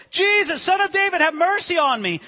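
high-pitched pleading call; crying out. Acted. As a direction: call out high for mercy, as a preacher acting it. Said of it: "he cried out".

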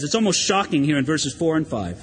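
normal talking voice

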